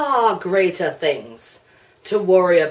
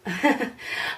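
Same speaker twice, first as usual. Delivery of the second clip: a short, breathy startled laugh; 3 semitones higher, 8 dB quieter than usual. Real, not acted. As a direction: laugh in surprise at the list. Surprised laugh at the questions.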